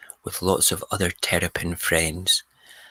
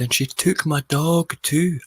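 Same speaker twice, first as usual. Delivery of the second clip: high, slow mock yell; retold shout; 10 semitones higher, 4 dB louder, slower than usual. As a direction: imitate a neighbour shouting out of a window. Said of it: "Lee started shouting and says".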